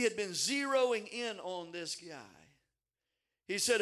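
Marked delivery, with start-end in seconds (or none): none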